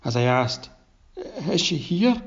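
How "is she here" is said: barely holding it together, much higher; unsteady voice. A narrator's reading. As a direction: voice lifting high and wavering. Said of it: "I failed to keep my voice steady".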